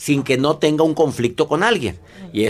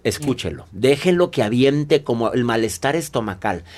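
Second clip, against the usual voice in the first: usual speaking voice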